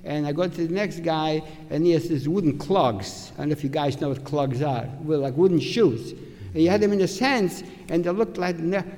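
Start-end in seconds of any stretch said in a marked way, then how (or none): none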